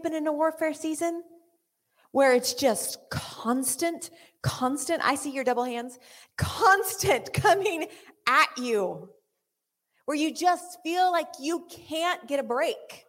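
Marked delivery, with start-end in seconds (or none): none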